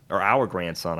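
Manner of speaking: ordinary talk, nothing marked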